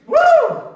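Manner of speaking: sharp high woo of disbelief; high loud whoop. Real, not acted. Preacher reacting to what happened to Jesus, high whoop. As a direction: let out a yelp at how rough.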